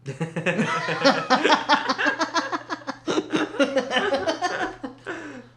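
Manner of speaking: long high laugh. Real, not acted. Pink curry remark.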